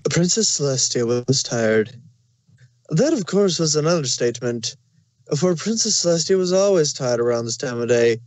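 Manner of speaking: ordinary delivery, nothing marked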